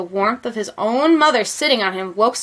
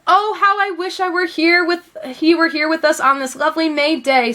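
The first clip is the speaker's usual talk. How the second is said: wistful wish; 7 semitones higher than usual. A narrator's reading.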